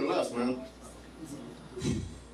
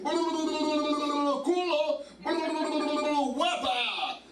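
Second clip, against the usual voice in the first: mock Spanish reggaeton; 10 semitones higher, much slower than usual. Comic on stage mocking [name]'s singing, in a high mock accent. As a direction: parody a reggaeton singer, pitched up. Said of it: "when I hear [name]".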